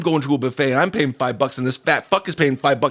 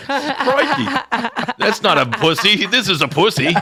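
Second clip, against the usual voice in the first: put-on high, loud Australian accent, laughing; hammed-up Australian accent, Crocodile Hunter style; 8 semitones higher, 3 dB louder than usual. Commenter reacting in a hammed-up Australian accent. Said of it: "That's my Australian accent".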